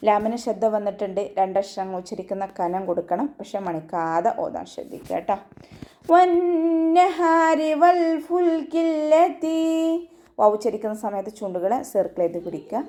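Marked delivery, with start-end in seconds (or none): 6.1-9.6 slow, high melodic tajweed chant; chanted Quranic verse, drawn out; Quran recitation. Shown as a demonstration. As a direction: stretch each syllable in a ringing recitation.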